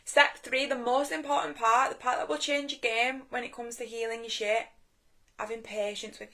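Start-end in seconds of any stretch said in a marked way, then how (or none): none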